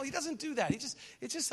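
normal talking voice

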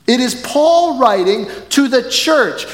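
usual speaking voice

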